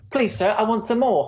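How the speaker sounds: high, pleading orphan voice; Dickens orphan voice. Retold or imitated. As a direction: beg like Oliver Twist. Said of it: "Charles Dickens".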